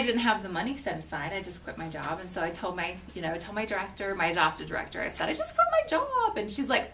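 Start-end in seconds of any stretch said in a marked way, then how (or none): 5.3-6.4 high, squeaky self-quote; high-pitched retold announcement. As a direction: blurt it out high, retelling it. Retelling her announcement to her director.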